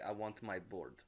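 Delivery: put-on heavy Russian accent, low and soft; a mumbled Russian accent, quietly. Whiplash asking Hammer for his bird, in a Russian accent. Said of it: "And he's like".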